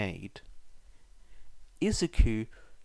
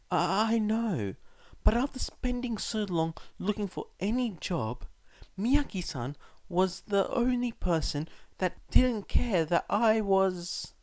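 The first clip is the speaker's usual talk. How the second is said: faltering explanation; 9 semitones higher than usual. A narrator's reading. Son trying to justify his job, faltering and high. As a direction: explain haltingly. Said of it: "Izuku tried to explain".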